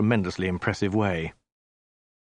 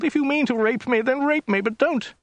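very high, desperate falsetto pleading; wailed; 3 dB louder than usual. A narrator's reading. Woman pleading at the great Khan's feet, wailed. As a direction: keep wailing, begging in falsetto. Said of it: "she wailed".

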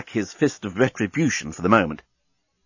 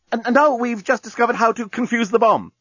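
flustered woman's voice; 12 semitones higher than usual. Acted. Lucy voiced in story.

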